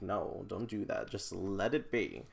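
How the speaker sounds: ordinary talk, nothing marked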